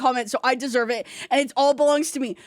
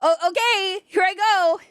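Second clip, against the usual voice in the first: normal talking voice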